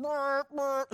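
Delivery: high, loud honking burp of a car alarm; car alarm imitation. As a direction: mimic the car alarm going off behind the wall. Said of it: "the alarm on my Tahoe ... going".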